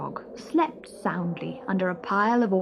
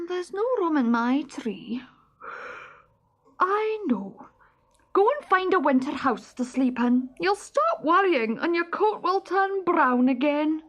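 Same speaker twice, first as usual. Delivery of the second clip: squirrel voice; 8 semitones higher than usual. Acted. Squirrel voice continues.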